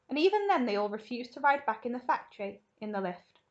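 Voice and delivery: plain reading voice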